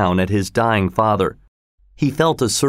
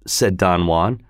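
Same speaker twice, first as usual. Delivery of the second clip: plain reading voice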